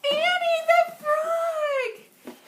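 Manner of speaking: high, loud, slow excited call; calling out a name. Acted. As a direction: sing out the name to wake her. Calling the puppet out.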